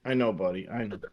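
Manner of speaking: soft, hushed comfort. Real, not acted. Soothing son.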